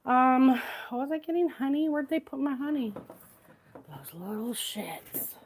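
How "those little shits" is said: hushed under-the-breath curse; whispered curse. Real, not acted. Muttered curse about kids taking honey.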